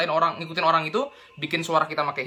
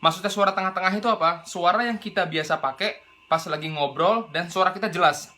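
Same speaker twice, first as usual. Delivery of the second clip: usual speaking voice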